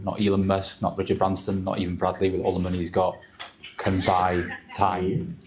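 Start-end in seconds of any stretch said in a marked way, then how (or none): 3.8-5.4 very slow, each word spaced; slow, drawn-out emphasis. Real, not acted. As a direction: stretch "can buy time" right out. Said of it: "Not a single person in this room can buy time".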